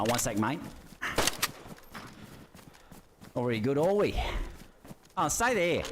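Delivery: lightly hammed Aussie mate voice; a hint of a rowdy Australian; Australian accent. Keeps the Aussie bloke voice.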